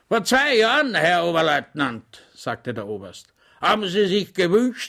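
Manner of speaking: put-on high, loud, blustering old colonel; grumpy rambling colonel. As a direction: lecture him pompously and grumpily. (mürrischer, polternder Oberst)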